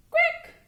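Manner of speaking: very high quack; duck quack. Shown as a demonstration. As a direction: squeak out a duck's quack. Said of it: "And a duck".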